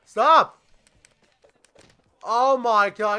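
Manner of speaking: loud, high plea; desperate shout. Real, not acted. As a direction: yell at the screen to make it stop. Begging opponent to stop.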